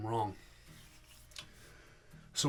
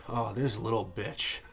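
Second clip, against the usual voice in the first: ordinary talk, nothing marked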